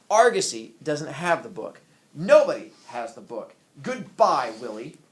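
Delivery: very loud, clipped wife; wife curtly ending the call with her husband. Acted. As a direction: hang up on him loudly.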